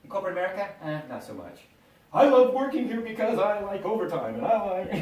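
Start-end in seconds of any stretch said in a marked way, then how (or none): 2.1-4.9 mock employee. Mocking corporate employee.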